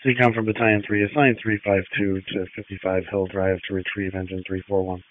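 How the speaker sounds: crackly two-way radio transmission; ambulance radio. Real, not acted. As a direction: voice through a handheld radio. Radio traffic over scanner.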